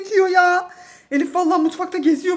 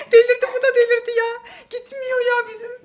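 laughing disbelief; 6 semitones higher than usual. Real, not acted. Chaos with the dog.

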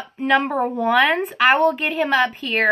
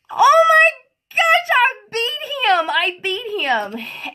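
retold excited disbelief; 9 semitones higher, slower than usual. Retelling winning the tube race, squealing in disbelief. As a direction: squeal it, stunned you won. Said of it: "I'm like".

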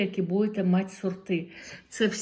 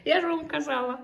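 delighted laugh; 8 semitones higher than usual. Real, not acted. Finds gift, delighted laugh.

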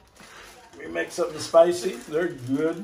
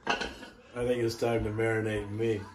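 quiet sly aside; 7 semitones lower, 11 dB quieter than usual. Acted. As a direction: lower and softer, a sly confiding twist. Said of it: "Actually, you know what?".

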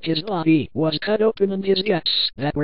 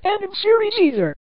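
high-pitched exasperated outburst; high, incredulous objection; indignant disbelief; 12 semitones higher than usual. A narrator's reading. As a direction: let the protest shoot up high. Narrator protests wrong characters appearing.

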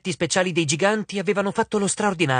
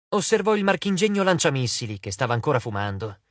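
plain reading voice

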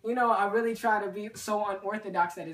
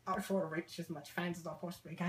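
put-on British accent; 2 semitones lower, 11 dB quieter than usual.

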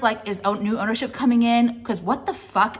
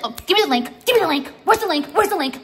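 higher, louder pestering mock voice; mocking whine; 8 semitones higher, 4 dB louder, faster than usual. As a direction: whine it like an annoying follower begging. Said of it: "And you're just like".

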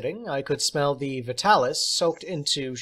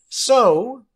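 high, louder teacher's voice; theatrical teacher; 10 semitones higher, 9 dB louder than usual. Acted. Substitute teacher praising John, high and theatrical. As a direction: play the odd substitute, grand and pleased.

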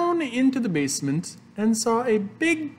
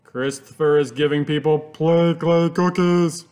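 loud, strained put-on voice; complaining parents; 2 semitones lower, 5 dB louder than usual. A narrator's reading. Parents phone the teacher about Christopher, loud complaint. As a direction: push it out in outraged complaint. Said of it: "they called up the teacher and said".

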